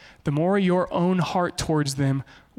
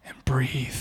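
whispered, softer breath cue; lower, whispered calm; 5 semitones lower, 4 dB quieter than usual. Shown as a demonstration. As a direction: breathe it out softly. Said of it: "hold your tongue".

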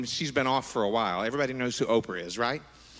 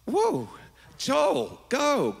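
sudden high, delighted cry of praise; excited thought; 11 semitones higher, much slower than usual. Retold or imitated. Worship leader cheering on Joel in her head, thrilled. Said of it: "I've thought".

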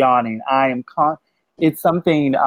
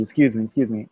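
ordinary talk, nothing marked

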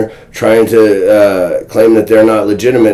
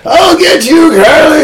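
sputtering foiled villain. Retold or imitated. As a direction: vow revenge like a beaten cartoon baddie. Foiled rival vows revenge.

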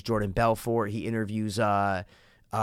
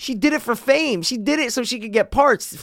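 higher, louder, worked-up telling; excited gossip; 10 semitones higher, 5 dB louder than usual. Real, not acted. Telling his friend the Anne Heche secret, excited. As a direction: spill the gossip like it's huge news.

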